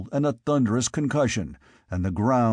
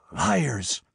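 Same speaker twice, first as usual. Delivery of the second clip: panting; 4 semitones higher than usual. Acted. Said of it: "panted Conan".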